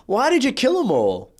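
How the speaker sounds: mock disbelief. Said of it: "he's just like".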